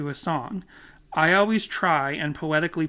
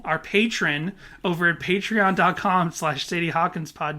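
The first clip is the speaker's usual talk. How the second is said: usual speaking voice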